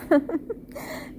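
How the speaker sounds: short laugh-sob; real emotion spilling over; tearful laugh. Real, not acted.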